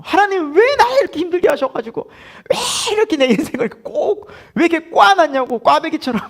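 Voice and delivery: high-pitched, exasperated mock lament; hammed-up grumbling plea, pitched high; acted pleading complaint. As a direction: whine up at God like a worn-out believer. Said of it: "하소연만 하고 돌아갈 때가".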